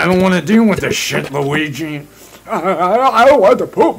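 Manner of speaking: high, loud panicky cartoon plumber voice; squeaky high voiced plumber complaining; mock Mario-Luigi squabble. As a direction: whine it like a scared cartoon brother. Voicing Mario and Luigi bickering.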